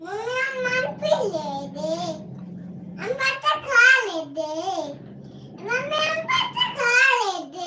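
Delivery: put-on high lisping toddler voice for the baby. Parent voicing the baby asking mummy for food, high baby voice. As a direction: speak for the little one in a tiny high baby voice.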